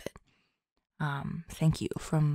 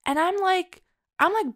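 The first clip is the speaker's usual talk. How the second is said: excited; 13 semitones higher, 5 dB louder than usual. Real, not acted. Excited lead-in to praise.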